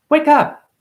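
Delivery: a high, loud shout of "Wake up"; loud wake-up call. Real, not acted. Preacher rousing listeners, high loud shout. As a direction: jolt them awake with a shout.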